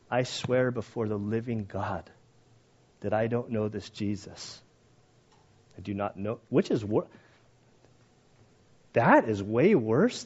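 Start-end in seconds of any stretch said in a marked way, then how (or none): 0.1-6.4 solemn oath. Retold or imitated. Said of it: "I would take the profanity over".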